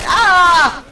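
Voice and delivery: high, loud comic yelp of panic; freaked-out scream. Retold or imitated. Player retelling being asked where in Russia, panicked scream. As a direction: scream as if caught out. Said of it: "I freaked out".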